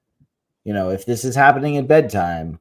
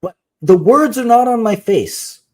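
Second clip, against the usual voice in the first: exasperated parent, higher pitched; frustrated; 6 semitones higher than usual. Retold or imitated. Mother telling daughter to look at the book, frustrated. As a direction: re-enact losing patience with a child. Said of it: "I get frustrated and I say".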